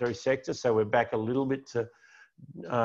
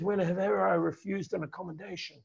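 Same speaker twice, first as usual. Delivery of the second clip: put-on higher, Italian-American mobster accent like the Godfather; 8 semitones higher, 3 dB quieter than usual. Quoting the Godfather to viewers in a mobster Italian-American accent. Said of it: "you've got to say this with an accent".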